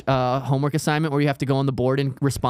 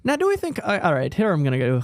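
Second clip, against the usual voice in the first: ordinary talk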